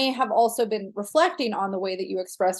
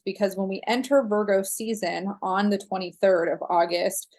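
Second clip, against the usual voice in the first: ordinary delivery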